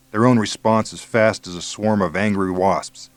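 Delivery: usual speaking voice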